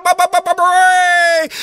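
be the whole crowd roaring; crowd cheer. Acted. Child voicing the cheering stadium crowd, high and excited.

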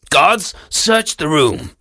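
slow, measured royal order; commanding king. Acted.

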